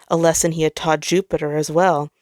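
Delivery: ordinary delivery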